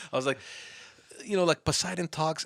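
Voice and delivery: ordinary delivery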